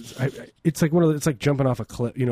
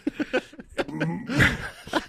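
high laugh; 13 semitones higher, 4 dB quieter than usual. Real, not acted. Laughing at cohost's bit.

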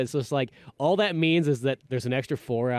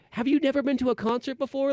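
mock exasperation; 12 semitones higher than usual.

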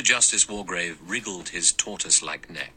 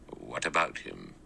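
cold; 7 semitones lower, 7 dB quieter than usual. Acted. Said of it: "looked at him unblinkingly".

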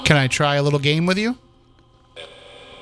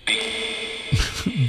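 airy high giggle; breathy high laugh; 8 semitones higher, 4 dB quieter than usual. Real, not acted. Laughs during number game.

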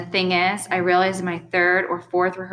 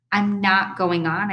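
ordinary delivery

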